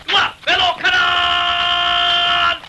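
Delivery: long drawn-out barked order, slow; drill command shout. Acted. Drill sergeant calling a turn to marching troops, drawn-out shout. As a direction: stretch the command out across the parade ground.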